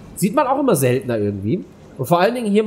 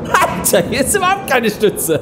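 excited exclamation; 9 semitones higher than usual. Real, not acted. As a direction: burst out in disbelief. Riding coaster, shocked.

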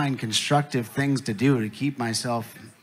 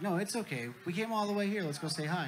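a higher, soft coaxing voice; lightly voiced reassuring dad; 5 semitones higher, 8 dB quieter than usual. Alien dad urges son to greet human, reassuring. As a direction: coax a hesitant child along.

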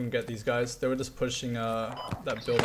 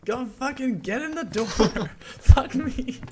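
frustrated yelling; 11 semitones higher, 4 dB louder, slower than usual. Real, not acted. Frustrated yell turning to laugh.

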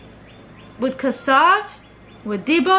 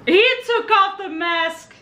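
high-pitched disbelief, stretched out on the repeat; astonished; 6 semitones higher, slower than usual. Real, not acted. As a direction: marvel at the reveal, high and incredulous. Still amazed at the unmasking.

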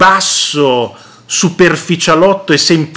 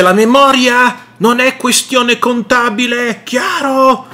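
higher, pompous mock voice; mocking Bonaccini; 8 semitones higher than usual. Regional president scolding the defence minister, higher mock lecturing voice. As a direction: pitch it up to mock a lecturing official. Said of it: "dice Bonaccini".

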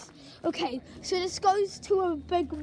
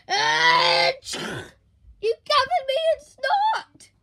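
furious yell; angry shout; 8 semitones higher, 9 dB louder than usual. Acted. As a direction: shout the accusation. Angry accusation.

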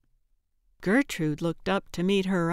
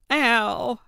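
accusing; 3 semitones higher, 4 dB louder than usual. A narrator's reading. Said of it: "she said accusingly".

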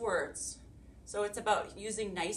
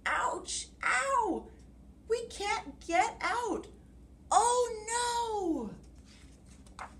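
groaning monkey; 14 semitones higher, much slower than usual. Acted. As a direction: yelp like you just hit the tree. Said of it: "groaned Monkey".

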